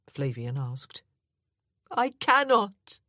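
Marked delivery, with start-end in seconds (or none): 1.9-3.0 high, loud, slow cry; tearful protest; anguished refusal. A narrator's reading. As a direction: wrench the words out in despair. Distraught woman refuses love.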